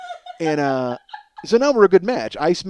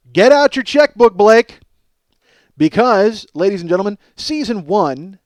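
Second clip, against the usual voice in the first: announcer pitch; 6 semitones higher, 10 dB louder than usual. Acted. Announcing episodes for sale.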